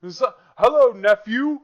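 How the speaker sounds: loud, high-pitched rapper-uncle greeting. Acted. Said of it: "He's like [name], but, like, Olympian version".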